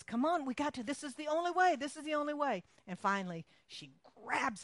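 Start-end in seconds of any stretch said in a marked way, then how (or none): 0.0-2.6 higher, louder insistent coaxing; pressing the frightened boy along; urgent aunt. Retold or imitated. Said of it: "And she says".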